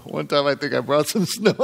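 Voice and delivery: chuckling through the words; laughing. Real, not acted. Preacher laughing while telling the congregation about yellow snow. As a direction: laugh as you confess it.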